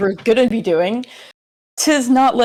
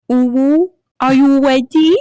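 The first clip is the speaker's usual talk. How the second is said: higher, louder cutesy uwu voice; 6 semitones higher, 6 dB louder than usual. Acted. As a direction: do a silly cute voice. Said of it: "I can do an even worse voice".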